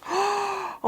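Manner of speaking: high gasp of amazement; awed gasp. Real, not acted. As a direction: gasp in wonder. Seeing new Bible.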